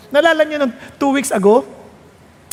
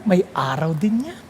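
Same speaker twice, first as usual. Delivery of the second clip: normal talking voice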